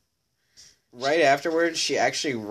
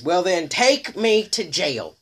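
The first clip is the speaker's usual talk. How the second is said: defiant dare; 8 semitones higher, 6 dB louder, slower than usual. Pretend. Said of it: "she told the deputies".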